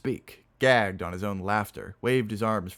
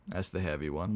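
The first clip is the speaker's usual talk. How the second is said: ordinary delivery, nothing marked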